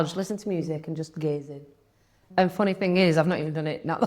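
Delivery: ordinary delivery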